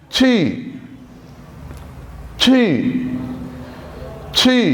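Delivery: छी said high and level, Mandarin tone; Chinese word demo. Shown as a demonstration. Teacher drilling छी for students, high level tone. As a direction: keep छी high and even. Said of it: "आखिरी लफ्ज है dear students वो है".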